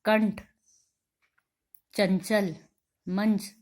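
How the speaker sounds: "कंठ", "चंचल", "मंच" with a pushed nasal n; forceful nasal sound. Shown as a demonstration. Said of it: "जोर लगा के बोला जा रहा है".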